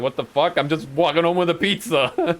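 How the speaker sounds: rising mock-indignant protest; retold indignation. Retelling his answer to a pizza heckler, mock-indignant. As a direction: protest your innocence, pitch climbing as you go.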